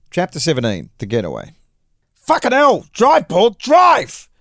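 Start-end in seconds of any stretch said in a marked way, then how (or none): 2.1-4.3 panicked yell, high and loud. A narrator's reading. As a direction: scream it from the back seat. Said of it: "we yelled".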